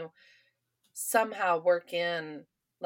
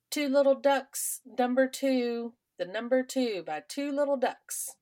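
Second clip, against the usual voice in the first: slow sing-song bingo call, higher; bingo caller; 5 semitones higher than usual. Retold or imitated. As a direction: call the number like a bingo host. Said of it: "William held up a ball and said".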